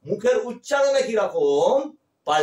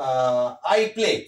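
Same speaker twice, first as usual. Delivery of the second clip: slow demo; 7 semitones lower, much slower than usual. Shown as a demonstration. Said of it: "একটা normal ভার্বকে যখন পাই".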